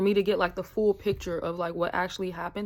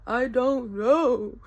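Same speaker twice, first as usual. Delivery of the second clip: slow, higher, louder mock wail; helpless wail; 4 semitones higher, 5 dB louder, much slower than usual. Friends ask what happened, retold helpless wail. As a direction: draw it out, imitating helpless distress.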